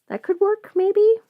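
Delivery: usual speaking voice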